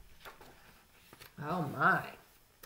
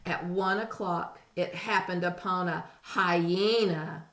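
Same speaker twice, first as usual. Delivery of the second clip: ordinary delivery, nothing marked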